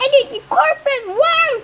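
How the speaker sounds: high-pitched screamed insults; shrieked, enraged taunts; furious screaming. A narrator's reading. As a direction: scream abuse at an enemy, pitch way up. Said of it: "she screamed".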